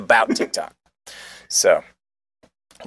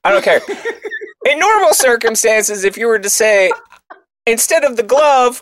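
worked-up rant; 10 semitones higher than usual. Real, not acted. Disgusted rant about the video.

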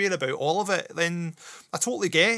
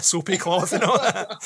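laughing; 5 semitones higher than usual. Real, not acted. Laughing at his own joke.

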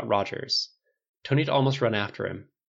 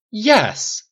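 loud, high burst of anger; angry shout; 12 semitones higher, 10 dB louder than usual. A narrator's reading. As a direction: shout it back, exploding. Father's outburst in acted quarrel.

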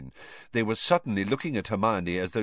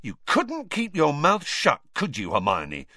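pointed, irritated rebuke; angry; 7 semitones higher than usual. A narrator's reading. Ron blames Hermione as the kitchen door slams, angrily. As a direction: scold with a raised pitch. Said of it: "said Ron angrily".

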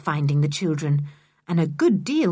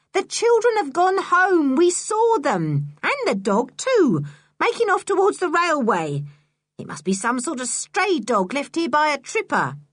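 a shrill-pitched scheming woman; Missus Stick's voice; 9 semitones higher than usual. Acted.

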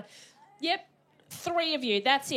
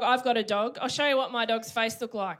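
ordinary delivery

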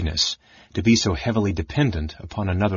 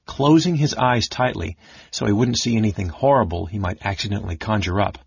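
usual speaking voice

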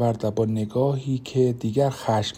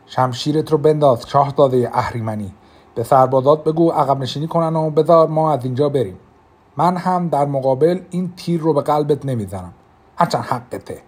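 commanding and threatening; 4 semitones higher, 6 dB louder than usual. Acted. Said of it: "با لحنی آمرانه و تهدیدآمیز گفتم".

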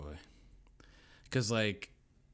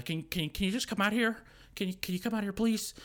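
high, stammering nervous plea; retold high-pitched edgy request; on edge; 11 semitones higher, 3 dB louder than usual. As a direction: imitate a jumpy man asking anxiously. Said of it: "He was just on edge".

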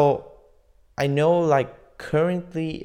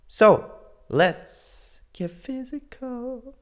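high, slow, drawn-out announcement; high announcer voice; 12 semitones higher, much slower than usual. Acted. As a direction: stretch it out like a game show host. Playful sung-out announcement.

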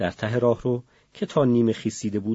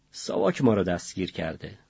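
usual speaking voice

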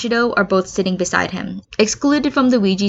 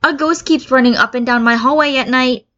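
exclaiming; 6 semitones higher, 4 dB louder than usual. A narrator's reading. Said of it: "Hagakure exclaims".

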